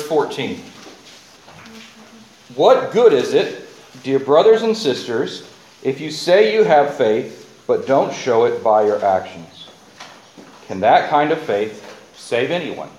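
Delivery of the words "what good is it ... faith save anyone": scripture reading. Acted. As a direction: read the verse like a searching question. Reads scripture aloud.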